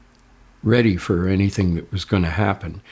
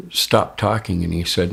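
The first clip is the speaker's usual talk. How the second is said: ordinary talk, nothing marked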